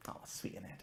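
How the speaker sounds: soft breathy whisper, no voice; whispered aside. Real, not acted. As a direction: lean in and whisper it like a secret. Aside to the audience.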